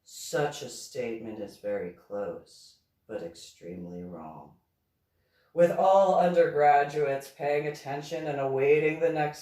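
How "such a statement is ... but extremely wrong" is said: low, soft monotone reply. A narrator's reading. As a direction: state it evenly, sinking lower and quieter. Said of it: "to state in a monotone voice".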